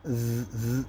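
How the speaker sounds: ز as a soft th buzz, tongue between teeth. Shown as a demonstration. Teacher isolating the sound of zaal, ز softly buzzed. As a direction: buzz ز lightly on the tongue tip. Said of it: "زبان کو دانتوں سے باہر نکال کر".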